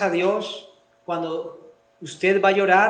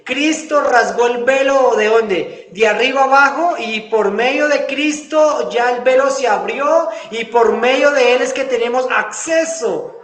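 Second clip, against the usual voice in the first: louder, higher preaching voice driving each phrase home; preaching at full force; 4 semitones higher, 4 dB louder than usual. Real, not acted. Pastor proclaiming to his congregation about access through Christ, loud preaching. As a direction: proclaim it to the whole church, full volume.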